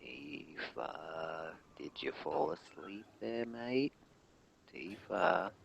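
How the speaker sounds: very soft, barely audible calling; hushed call to sleeper. Pretend. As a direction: check very quietly whether they are asleep. Calling softly to someone maybe asleep.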